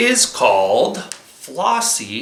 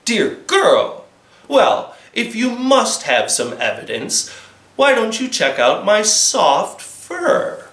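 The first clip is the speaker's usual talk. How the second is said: high indignant fox voice; annoyed fox, higher; 5 semitones higher than usual. Acted. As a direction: bristle like an offended fox. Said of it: "the fox got a little angry".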